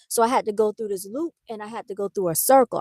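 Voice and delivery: ordinary talk, nothing marked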